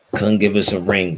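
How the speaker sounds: usual speaking voice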